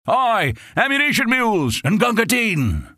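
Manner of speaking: the front line yelling for supplies; front line shout. Acted. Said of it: "you could hear the front line shout".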